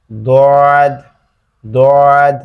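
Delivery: দদ from the tongue side against the molars, heavy and firm; Arabic dad demo. Shown as a demonstration. Teacher drills the letter dad for students, heavy and firm. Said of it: "লেগে উচ্চারিত হবে দদ".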